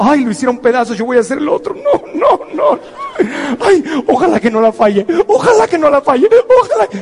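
high, whimpering mock panic; panicking player. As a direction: ham up a terrified player praying not to miss. Acts out panicking second penalty taker.

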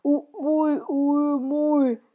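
high strangled "ui, mui" from a rusted mouth; a stiff rusty-jawed man straining to speak; creaky stove-maker. Retold or imitated. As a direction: squeeze out "U-, ui, ui, mui" as if the jaw barely moves. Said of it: "quando riuscì a dire".

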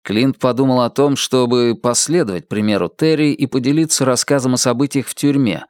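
ordinary delivery

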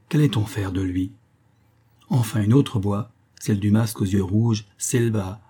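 usual speaking voice

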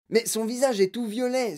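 storybook cry of surprise; startled exclamation. A narrator's reading. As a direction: exclaim it like a startled grandpa in a read-aloud. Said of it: "s'écria grand-papa Joe".

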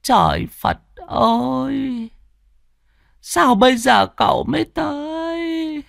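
put-on tearful old woman cry. A narrator's reading. Old woman finally greeting long-lost Trương, high tearful cry. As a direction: cry out to him after decades of waiting. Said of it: "Bà lão đôi mắt rưng rưng".

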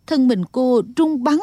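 plain reading voice

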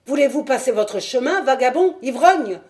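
crier plus fort et plus haut, en chassant un importun; cri furieux. Acted. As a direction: aboyer la menace à travers la fenêtre. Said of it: "dit une voix". (vieillard qui crie, furieux, depuis sa fenêtre)

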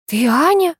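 high, rising, dazed question; dumbfounded high-pitched query; stupefied. A narrator's reading. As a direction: repeat it blankly, unable to believe it. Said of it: "тупо повторяла я".

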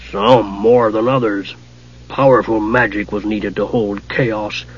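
low, measured god's growl. A narrator's reading. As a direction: growl it slowly as a horned god. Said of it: "growled Sargonis".

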